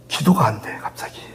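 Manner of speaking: mother whispering on the phone; whispered worry. Retold or imitated. Mother's worried words, retold.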